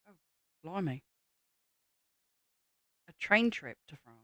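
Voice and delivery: ordinary talk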